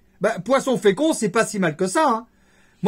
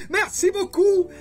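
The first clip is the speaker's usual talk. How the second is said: high, slow, beaming merci beaucoup; excited thanks; 12 semitones higher, slower than usual. Real, not acted. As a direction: stretch out a delighted thank you. Thanking subscribers, second take plain.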